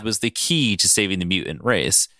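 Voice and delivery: low villain rhetoric; Mister Sinister. Acted. Sinister dodges Logan's question, low and composed. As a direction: pose the question deep and cool. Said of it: "Sinister just goes".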